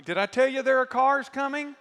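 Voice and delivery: retelling the near crash, rising excitement; excited. Real, not acted. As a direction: punch the question up, thrilled and alarmed. Rising storytelling suspense.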